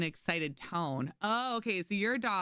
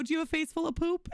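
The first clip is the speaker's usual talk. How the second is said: playful dog talk; 10 semitones higher, faster than usual. Retold or imitated. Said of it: "And I'll go".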